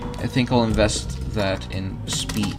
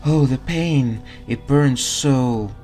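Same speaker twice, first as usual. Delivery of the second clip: slow, louder, higher ghostly wail; 4 semitones higher, 5 dB louder, slower than usual.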